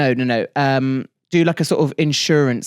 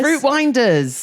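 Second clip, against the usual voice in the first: high, slow jingle voice; goofy ad voice; 10 semitones higher, much slower than usual. Shown as a demonstration. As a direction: stretch it out, pitched up. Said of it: "Sort of goofy and funky".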